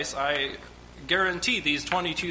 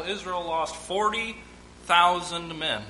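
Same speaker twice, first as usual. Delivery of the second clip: usual speaking voice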